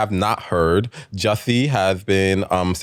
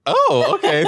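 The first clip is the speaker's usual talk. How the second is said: surprised. Real, not acted. Surprised reaction to new intro.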